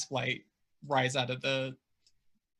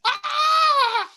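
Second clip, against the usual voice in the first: high, loud monster shriek; creature shriek; 9 dB louder than usual. Acted. Game master sounds the Shrieker's alarm, shrieking. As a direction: shriek it out at full voice. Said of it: "Shrieker one shrieks!".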